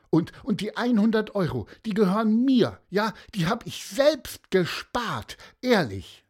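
high, stammering protest; high-pitched, urgent self-defence; pleading little boy. A narrator's reading. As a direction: insist earnestly as an accused child. Accused boy protests innocence.

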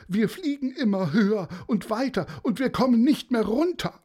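high, stammering frantic man; the pilot pours out the trouble, high; panicked balloon pilot. Acted. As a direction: rush the words in rising panic.

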